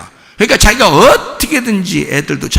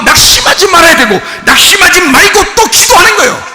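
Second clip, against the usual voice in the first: loud, high preaching at full force; fiery preaching; 9 semitones higher, 7 dB louder than usual. Acted. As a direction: thunder it to the congregation. (힘차게 외치는 설교)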